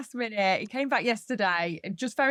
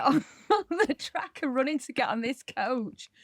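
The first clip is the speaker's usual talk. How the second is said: ordinary talk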